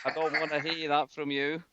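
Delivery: deliberate, hammed-up northern English accent impression; Charles Green accent. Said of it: "pick up the, the new guy's accent".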